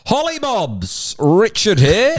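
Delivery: high, slow New York boss voice; drawled New York businessman; New York accent. Acted. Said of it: "New York accent".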